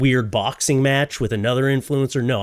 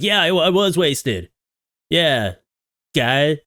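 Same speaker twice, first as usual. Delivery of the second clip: mock wasted guy; 4 semitones higher, slower than usual. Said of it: "played it off like".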